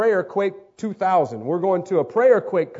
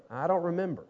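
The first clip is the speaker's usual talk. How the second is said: softer, deflated reply; lightly acted blank admission; mock sheepish answer; 8 dB quieter, faster than usual. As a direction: drop the volume as if caught out.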